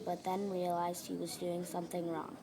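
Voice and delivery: plain reading voice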